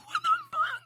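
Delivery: very high shocked squeal; a real startled shriek. Real, not acted. Shocked at fetish content.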